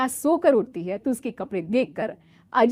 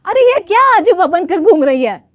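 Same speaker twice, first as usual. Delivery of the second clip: louder, higher outraged mother-in-law; shocked scolding; 9 semitones higher, 10 dB louder than usual. Acted. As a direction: scold the bride in disbelief. (चौंककर डाँटती सास)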